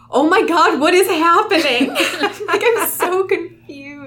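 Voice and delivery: loud high laughing panic; all caps. Retold or imitated. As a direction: shout it, laughing and confused. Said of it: "all caps".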